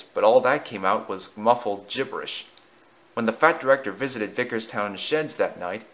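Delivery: plain reading voice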